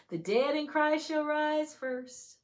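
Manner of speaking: high, loud, drawn-out scripture; ringing preacher's proclamation; exultant proclamation. Real, not acted. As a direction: declare it slowly and triumphantly.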